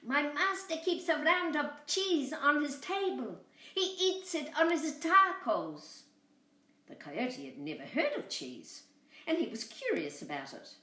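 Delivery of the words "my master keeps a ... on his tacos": high, louder lamb voice. Acted. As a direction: sell it high and eager. Lamb voice continues.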